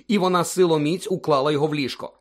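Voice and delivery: usual speaking voice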